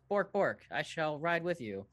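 high, loud silly cat. Acted. Cat Mister Sparkles offering to ride along. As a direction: squeak it out like a pompous cat. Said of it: "the cat, uh, goes".

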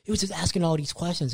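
ordinary talk

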